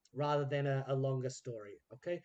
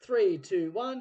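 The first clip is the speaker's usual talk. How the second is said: louder countdown for the second take; calling the countdown; 5 semitones higher, 7 dB louder, slower than usual. Retold or imitated. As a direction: count the team in again.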